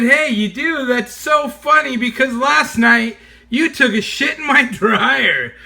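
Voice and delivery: gleeful gotcha, breaking into laughter; host gloating at hungover guest, laughing; laughing taunt. Retold or imitated. Gleefully telling guest the truth.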